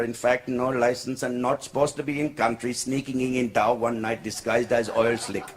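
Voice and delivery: put-on low-pitched rambling Indian English accent. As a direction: babble excuses in an Indian accent. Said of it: "Indian guy in Dubai".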